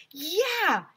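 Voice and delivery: high loud yeah; boys yelling. A narrator's reading. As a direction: shout it like eager boys. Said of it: "yelled all the boys".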